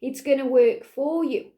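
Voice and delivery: usual speaking voice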